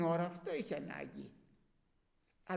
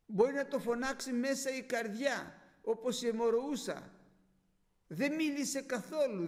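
usual speaking voice

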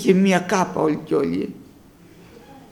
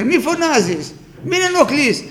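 hushing; 7 semitones higher than usual. Acted. Crowd hushing the blind man.

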